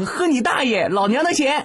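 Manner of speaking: high, sharp and scornful; scornful retort. Acted. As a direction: snap back like an offended woman. Girl slaps him and retorts.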